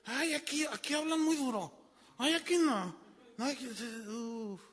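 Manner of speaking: high-pitched mock whining complaint; put-on high voice of protest; offended churchgoer. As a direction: imitate someone squirming away from a hard sermon.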